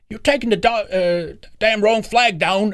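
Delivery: high-pitched mock English working-class bloke; put-on working-class English accent. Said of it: "English working class".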